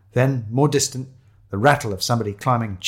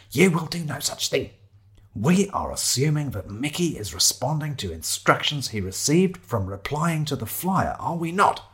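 lightly voiced, officious lecturer; bossy Shiloh; 5 semitones higher than usual. Shiloh stopping companion from looking, bossy. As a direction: lay down the law, a touch higher.

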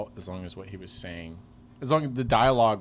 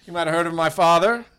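pompous heir; 8 semitones higher, 13 dB louder than usual. Pretend. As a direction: announce his rich father's name like an old-money heir. Mock rich heir introduction.

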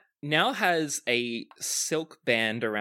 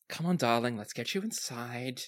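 softer, dropping to a whisper at the end; hushed dwarf aside; 2 semitones lower, 5 dB quieter than usual. Acted. Dwarf whispering to the old lady about customers, hushed. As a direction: murmur it privately to his wife.

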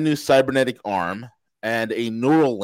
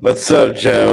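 robotic, glitchy clipped audio; distorted, stretched-out voice; clipping distorted mic; 8 dB louder, much slower than usual. Real, not acted. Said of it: "Your, your mic is clipping really bad".

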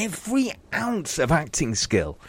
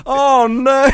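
mock dismay; 11 semitones higher, 4 dB louder than usual. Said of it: "I went".

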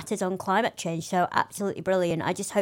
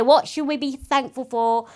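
normal talking voice

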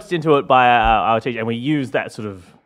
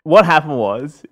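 normal talking voice

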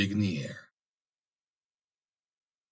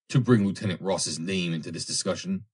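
ordinary delivery

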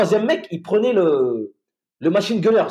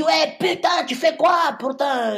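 put-on high shouted Ivorian-accented yell; 10 semitones higher, slower than usual. Drunk Ivorian checkpoint guard yelling at soldiers, high shouted Ivorian accent. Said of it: "avec son accent ivoirien".

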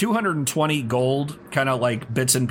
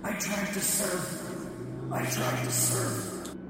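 high breathy whisper, slow and haunting; raspy ghostly whisper; 8 semitones higher, 11 dB quieter, slower than usual. Acted. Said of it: "a raspy voice saying".

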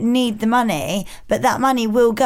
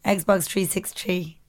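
mock Nigerian accent, dropping softer at the end; 3 semitones lower, 5 dB quieter, slower than usual. Shown as a demonstration. Host repeating the accent hack, put-on Nigerian accent. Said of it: "That's quite a good one".

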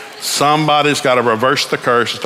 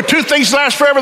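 usual speaking voice

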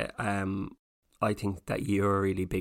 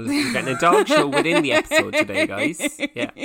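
much higher, laughing through words; 7 dB louder than usual. Real, not acted. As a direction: giggle the words out. Laughing announcement.

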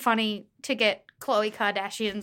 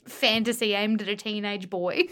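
ordinary delivery

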